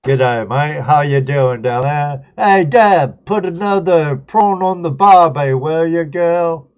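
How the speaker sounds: loud, high mock Australian greeting; Aussie accent. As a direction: ham up an Aussie accent, calling out. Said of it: "pathetic Aussie accent".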